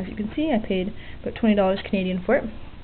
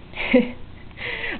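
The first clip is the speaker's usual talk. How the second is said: a bright, high burst of laughter; short laugh; 13 semitones higher, 4 dB quieter than usual. Real, not acted. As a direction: laugh at your own mess. Laughs at own messy soldering.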